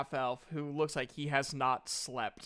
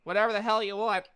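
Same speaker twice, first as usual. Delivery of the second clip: higher-pitched shopkeeper voice, a weary seller listing wares; tired half-elf merchant; 6 semitones higher than usual. Acted. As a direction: play the sleepless half-elf eager to sell.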